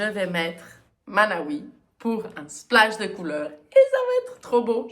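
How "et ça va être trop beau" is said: much higher and louder, gushing; excited. Real, not acted. Knitter picturing her finished cardigan, excited squeal. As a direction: burst out with delight. Thrilled about colour splash plan.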